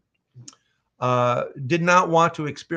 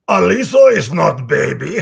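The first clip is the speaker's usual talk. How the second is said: Heavy's voice; 3 semitones higher, 11 dB louder than usual. Pretend. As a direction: bellow it like a hulking Russian gunner. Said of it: "could you read this in Heavy's voice".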